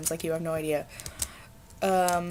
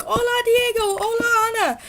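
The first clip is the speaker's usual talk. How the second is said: much higher, louder sing-song hola calls; mock screaming greeting; 6 dB louder than usual. Retelling classmates yelling Spanish names, high and loud. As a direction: shout hola across a chaotic classroom. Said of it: "everyone was screaming at each other".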